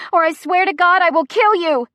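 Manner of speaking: loud, high, furious; shrieked threat. A narrator's reading. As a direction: scream the threat at full pitch. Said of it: "I hear Chrissy shrieking".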